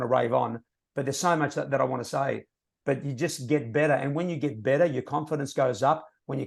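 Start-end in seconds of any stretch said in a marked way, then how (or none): none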